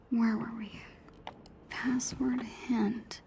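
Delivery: slow hushed whisper. Real, not acted. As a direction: whisper the clues slowly. Guessing password while sneaking.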